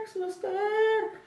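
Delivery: very high, loud call for the nurse; re-enacted yell for help; shouting. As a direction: call out urgently, stretching the word. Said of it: "Saya berteriak".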